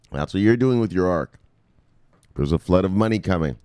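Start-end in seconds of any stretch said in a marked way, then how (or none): none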